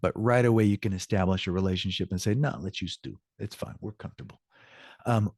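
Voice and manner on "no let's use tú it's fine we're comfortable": soft reassurance. Acted. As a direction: wave formality away softly, trailing off quieter. Acts out offering informal address.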